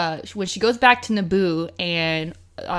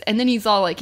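normal talking voice

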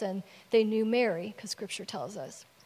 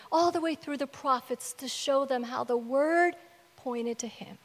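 normal talking voice

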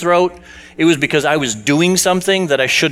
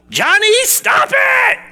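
much higher, shouted "stop it!"; screamed out; slower than usual. Retold or imitated. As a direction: yell at a misbehaving kid. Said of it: "they scream out".

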